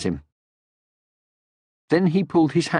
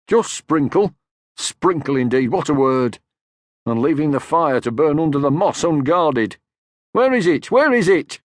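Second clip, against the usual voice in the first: higher, louder outraged fuss; a father's wounded outrage; indignant cry; 5 semitones higher, 4 dB louder than usual. Acted. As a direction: huff and puff in offended alarm. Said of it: "cried Moomin Papa".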